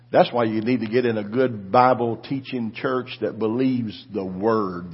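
ordinary talk

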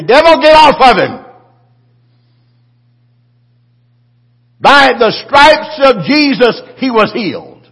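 loud high bold command, slowing on the stripes; commanding rebuke. Pretend. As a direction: cast the devil out with full force. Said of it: "I want somebody to say".

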